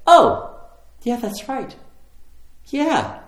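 high burst of oh, then agreeing; high-pitched retold aha; sudden realization. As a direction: act out the penny dropping.

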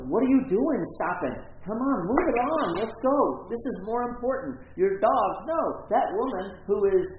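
high, loud, indignant complaining; Jairus, impatient. Acted. Jairus scolding Jesus for stopping for the woman, indignant and loud. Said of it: "That's Jairus".

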